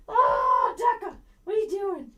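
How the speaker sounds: pet talk. Real, not acted. Calling to the dog.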